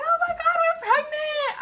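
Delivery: very high, loud, joyous cry; high squeal of happy shock; overjoyed shriek. Retold or imitated. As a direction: burst out in disbelief and joy. Seeing the positive test line.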